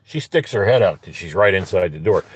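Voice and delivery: normal talking voice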